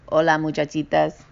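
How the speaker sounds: ordinary delivery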